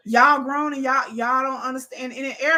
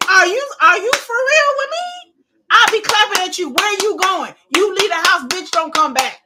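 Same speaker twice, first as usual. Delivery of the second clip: loud, higher, indignant at the leaving partner; you really gonna walk out? loud and higher; mock outraged rant; 6 semitones higher, 8 dB louder than usual. Ranting at a partner who walks out, loud and higher.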